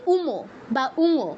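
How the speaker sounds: উমো and উঙো as two-syllable names, 'u-mo', 'u-ngo'; everyday Bengali naming of উঙো; Bengali letter name. Shown as a demonstration. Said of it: "বাংলায় পাঁচ নম্বরটাকে কি বলি".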